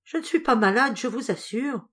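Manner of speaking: high, pressing, a touch of worry; anxious insistence. A narrator's reading. Sandrine to Madame d'Alignac, anxious repeat. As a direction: insist again, uneasy underneath. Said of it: "comme une inquiétude quand elle répéta".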